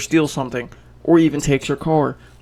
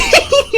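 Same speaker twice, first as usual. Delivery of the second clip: high laugh; 7 dB louder than usual. Real, not acted. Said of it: "I love that response".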